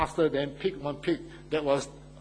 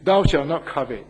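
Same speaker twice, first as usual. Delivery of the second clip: preaching the commandment; 8 dB louder than usual. Retold or imitated. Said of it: "stand up here to speak".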